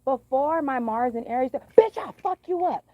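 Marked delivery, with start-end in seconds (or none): 1.8-2.9 loud, high threatening yell; explosive, high-pitched shouted threat; old-style blow-up. Acted. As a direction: yell it like picking a fight. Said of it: "I don't do that no more".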